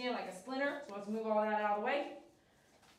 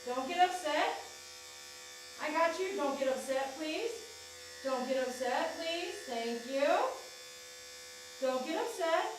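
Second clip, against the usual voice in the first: coaxing pet voice; 3 semitones higher than usual. Real, not acted. Groomer soothing the tense dog on the table, high pleading coax. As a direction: plead with the dog to stay calm.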